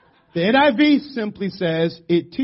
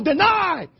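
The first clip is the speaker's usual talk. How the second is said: high imitated yell; coach's yell; 10 semitones higher than usual. Coach calling the play to players, high imitated yell. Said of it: "But he would yell".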